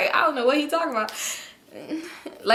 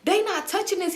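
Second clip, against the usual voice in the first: higher-pitched indignant imitation; mock offended; 8 semitones higher than usual. Man protective of his drug, high offended imitation. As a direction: play his affronted refusal, pitched up. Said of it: "He getting so mad".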